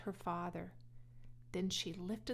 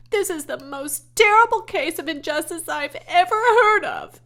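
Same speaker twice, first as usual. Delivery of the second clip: high, loud indignant cry; girl raging at her father's cruelty; outraged protest; 9 semitones higher, 18 dB louder than usual. A narrator's reading. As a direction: wail at the injustice.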